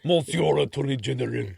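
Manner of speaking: mushy, exaggerated French accent; hammed-up, terrible French accent; really bad French accent. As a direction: do a deliberately poor French accent. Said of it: "read it again in my really bad one".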